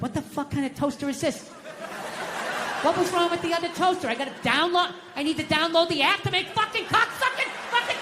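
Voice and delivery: mock rant, pitched high; hammed-up frustrated outburst at a gadget; ranting at toaster. Dad ranting at the new toaster in the kitchen, mock angry rant.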